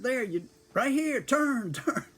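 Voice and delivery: high, loud urgent directions; urgent shouting. Retold or imitated. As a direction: yell the turn in a panic.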